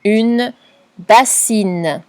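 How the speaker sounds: each word spoken very slowly and clearly; drawn-out dictation voice. Shown as a demonstration. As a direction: dictate it slowly for a child to write down. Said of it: "Mot suivant".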